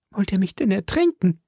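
high, wailing, frightened question; whimpering. A narrator's reading. Milady is carried to the boat, whimpering high in fear. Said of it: "wimmerte sie".

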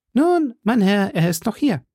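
high voice of an obliging innkeeper; innkeeper woman. Acted. Innkeeper answers the musketeer.

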